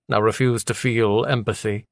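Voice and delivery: ordinary delivery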